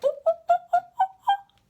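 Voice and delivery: high loud yapping woofs; playful bark imitation. Shown as a demonstration. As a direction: yap like a little dog to tease. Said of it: "and monkey, woof".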